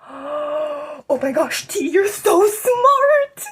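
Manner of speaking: sarcastic dreamy praise; mock awe. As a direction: drag it out in exaggerated admiration.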